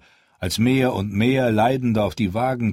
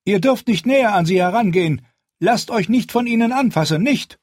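loud and very high, rising to a desperate "nicht!"; urgent pleading; 13 semitones higher, 5 dB louder than usual. Acted. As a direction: beg her to stay back, voice climbing. Guard pleads with his queen.

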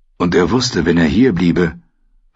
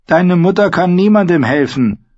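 put-on high-pitched, loud old woman; old woman's raised voice; 11 semitones higher, 6 dB louder than usual. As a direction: speak up high as an old crone.

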